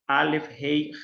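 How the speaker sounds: ordinary talk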